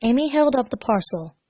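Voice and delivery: ordinary delivery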